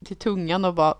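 ordinary talk, nothing marked

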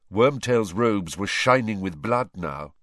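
usual speaking voice

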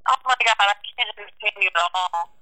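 garbled breaking-up phone line; fast, choppy voice over a bad connection; breaking up space radio. Real, not acted. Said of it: "Oh, you're breaking up".

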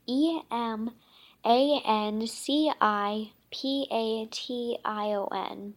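slow, letter by letter spelling; sounding out words. Acted. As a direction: spell each letter out slowly. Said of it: "Judy sounded the word out".

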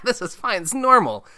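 high, loud mock-cheerful laughter; hammed-up breezy denial; laughing it off. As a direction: laugh through it as if all's well. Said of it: "everyone's, like, laughing".

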